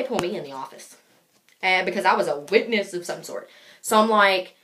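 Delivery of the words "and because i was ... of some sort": usual speaking voice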